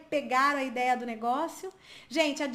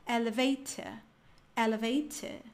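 "Elevator" with strong t, silent final r; British accent. Shown as a demonstration. Teacher recapping the British version, British accent. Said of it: "o R não é pronunciado".

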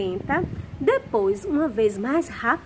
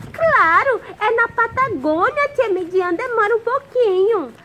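put-on puppet boy voice; 7 semitones higher, 6 dB louder than usual. Puppet boy Zezinho talks to teacher.

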